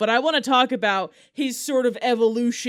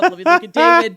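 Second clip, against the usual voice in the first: higher and louder, rushing to see; eager; 8 semitones higher, 8 dB louder than usual. Real, not acted. Host eager to see the Hoff's TikTok, excited. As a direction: grab for the phone in excitement.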